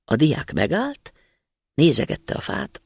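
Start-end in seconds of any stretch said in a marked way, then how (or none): none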